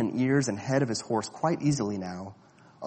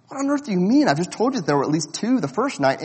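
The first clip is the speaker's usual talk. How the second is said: high, loud, incredulous outburst; a boy objecting that there were two lions; indignant disbelief; 8 semitones higher, 5 dB louder, faster than usual. A narrator's reading. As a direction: protest in disbelief.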